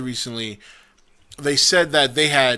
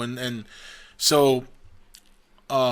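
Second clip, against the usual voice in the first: ordinary talk, nothing marked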